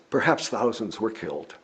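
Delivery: ordinary delivery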